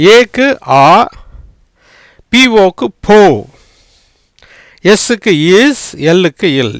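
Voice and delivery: each letter's sound called out slowly; teacher sounding out each part. Shown as a demonstration. Teacher sounds out letters for Tamil students, clearly and deliberately. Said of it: "இப்ப எப்படி வரும் அப்படின்னா".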